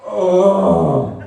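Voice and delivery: loud, long, hammed-up groan; big dad groan. Dad hearing about the hamster on the phone, big hammed-up groan. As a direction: groan loudly like an exasperated dad. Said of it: "one of those big dad groans".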